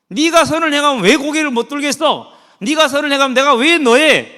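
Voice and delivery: loud, high stern warning; God sternly warning Cain, loud and forceful; forceful rebuke. Retold or imitated. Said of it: "하나님이 아주 강하게 말씀하셨잖아요".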